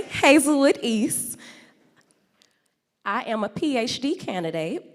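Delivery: ordinary talk, nothing marked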